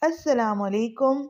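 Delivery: ordinary delivery, nothing marked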